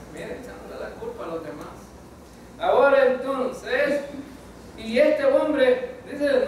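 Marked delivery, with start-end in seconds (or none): 2.6-6.5 slow, drawn-out preaching; slow resumption. Real, not acted. Preacher takes the congregation back to the king's story, slowly. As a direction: stretch each word as you return to the story.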